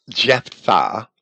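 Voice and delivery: Jephthah with an English J, "Jeff-thah"; common pronunciation. Shown as a demonstration. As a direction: say Jephthah starting with J, not Y. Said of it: "commonly pronounced".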